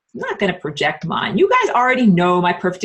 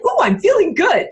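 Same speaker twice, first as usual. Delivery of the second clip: delighted; 11 semitones higher, 4 dB louder than usual. Retold or imitated. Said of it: "I'm like".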